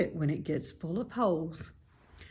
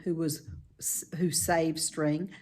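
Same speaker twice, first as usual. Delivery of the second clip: usual speaking voice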